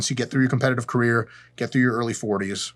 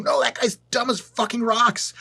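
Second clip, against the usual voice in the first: higher, slow, irritated; getting pissed; 10 semitones higher than usual. Real, not acted. Vlogger vents about dumb pros, real irritation. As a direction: spit out the frustration slowly. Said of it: "Can you tell I'm getting pissed?".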